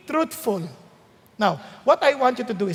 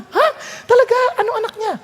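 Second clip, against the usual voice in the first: amazed disbelief; 10 semitones higher than usual. Retold or imitated. Friend hearing the car had babies, amazed high disbelief. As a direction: act floored by the news.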